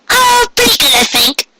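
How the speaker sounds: a bright start that falters; Pinkie Pie. A narrator's reading. Pinkie answering Carrot on the stairs, high then doubtful. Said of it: "she said with a little smile".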